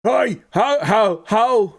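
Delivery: high, loud-ish dopey stammer; hammed-up dim-witted hello; mock clueless greeter. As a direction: act clueless, stuttering on a high pitch. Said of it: "walking up to someone and going".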